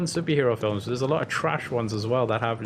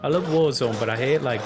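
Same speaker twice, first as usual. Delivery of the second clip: ordinary delivery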